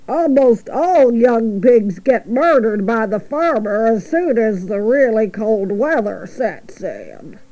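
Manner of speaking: slow, drawn-out sheep voice; unhurried drawl of an old ewe. Acted. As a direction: drag out the words as a gossipy old sheep. Sheep continues grim news.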